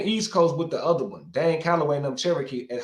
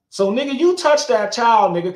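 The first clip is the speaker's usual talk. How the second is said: higher, louder accusing yell; accusing shout at the man in the video; angry call-out; 7 semitones higher, 8 dB louder than usual. Acted. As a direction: call him out hard, loud and high.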